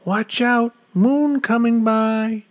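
high, loud warning call; yell. A narrator's reading. Rider warning the goat of the coming moon, yelling. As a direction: call it out high across the sky. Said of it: "Yell to the goat".

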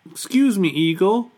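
high, polite little Moose; Moose's polite voice. A narrator's reading. Moose politely approaches Eagle.

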